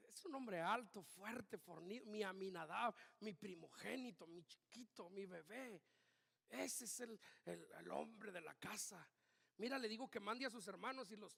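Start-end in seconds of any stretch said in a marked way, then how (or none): 0.1-8.5 softer, fond proud father; a hint of a doting dad bragging. Father bragging to the prophet about his eldest, lightly voiced and soft. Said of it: "Su papá decía".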